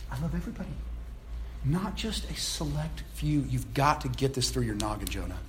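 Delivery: soft, low-key patient reproof; gentle correction. Acted. God to stubborn Jonah, softly correcting. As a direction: reason with him quietly, dropping low at the end.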